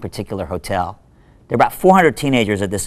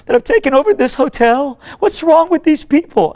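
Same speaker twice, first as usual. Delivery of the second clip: high, loud mock-distraught woman; imitated tearful outburst; 6 dB louder than usual. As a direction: act out an upset cleaning lady bursting out. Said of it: "she just bursts out in tears".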